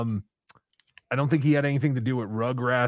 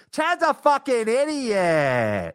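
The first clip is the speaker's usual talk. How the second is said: higher, slow put-on yell-voice; Kevin Brennan; 8 semitones higher, much slower than usual. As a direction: drag it out mocking him. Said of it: "he's the guy who goes".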